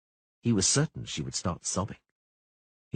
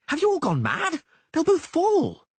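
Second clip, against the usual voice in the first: high, loud outraged yell; outraged shout; 6 dB louder than usual. Acted. Dandelion furious at the onlookers, high loud yell. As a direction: rage at their cowardice.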